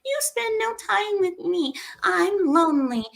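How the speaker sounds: mock-shrill nagging wife; nagging woman. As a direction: whine like a needy wife. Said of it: "A woman nagging".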